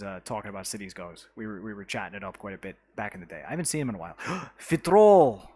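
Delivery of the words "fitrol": excited gasp. Real, not acted. Excited viewer arrives in chat.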